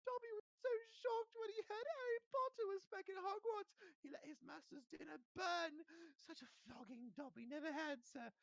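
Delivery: very high squeaky elf voice; a loyal servant confessing; Dobby owns up to Harry, squeaky and earnest; eager house elf. Acted.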